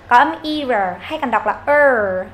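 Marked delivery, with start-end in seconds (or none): 1.6-2.3 long "er" with the tongue curled in; curled-tongue R sound. Shown as a demonstration. Teacher isolating the R for learners, drawn-out "er". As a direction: hold "er" with slightly rounded lips. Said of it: "âm R cong lưỡi vào".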